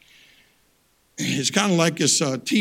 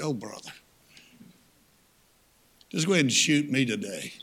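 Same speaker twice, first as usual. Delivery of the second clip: deflated; 4 semitones lower, 4 dB quieter than usual. Retold or imitated. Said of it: "you think".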